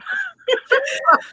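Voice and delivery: laughter breaking high; high laughing squeal. Real, not acted. After funny story.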